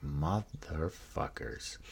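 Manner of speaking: soft, stretched-out disbelief; quiet realization. Retold or imitated. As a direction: draw the curse out softly. Sees handcuffs, realizes it's a setup.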